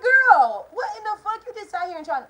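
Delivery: high, loud incredulous cry; shrieked mock confrontation, easing off at the end; outraged outburst. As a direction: burst out in retold disbelief. Her outraged reaction to mother, retold.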